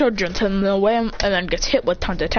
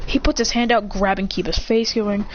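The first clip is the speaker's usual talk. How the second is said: plain reading voice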